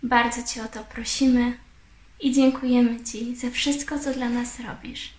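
usual speaking voice

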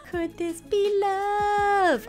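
high, very slow, dreamy swoon; swooning wonder. Real, not acted. Romance budding in the game.

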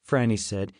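usual speaking voice

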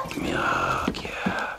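soft whispered invitation; whispered comfort. Acted. [name] soothing the cowboy, whispering a hug. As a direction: coax him gently in a whisper.

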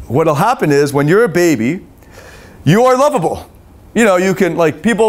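ordinary talk

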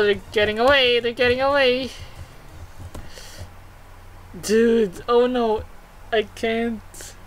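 ordinary talk, nothing marked

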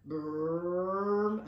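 loud, long rolled brrrm; ugly rumbling engine. A narrator's reading. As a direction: imitate a ground-shaking machine. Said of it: "an ugly sound that made the ground shudder".

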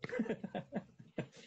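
short soft real laugh; amused laugh. Real, not acted. Laughing softly when the host asks about his accent.

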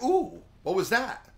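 high-pitched impressed "ooh"; mock amazement. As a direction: play a listener wowed by a sudden flourish. Voices an impressed listener's reaction.

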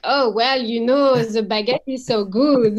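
a cheesy Frenchwoman, loud and overdone; caricature French accent. Retold or imitated. To her mum, a mock-French caricature voice. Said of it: "it's a caricature".

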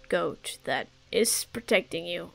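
a hint of a solemn elder; wise old mentor. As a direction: talk like an old teacher sharing secrets. Continues the mentor lecture.

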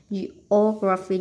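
Geography with a loud, drawn-out second syllable; Geography, second syllable punched; stress on second syllable. Shown as a demonstration. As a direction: lean hard on the O in Geography. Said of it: "nhấn âm hai".

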